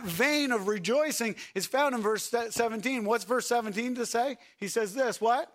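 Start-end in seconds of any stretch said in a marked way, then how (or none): none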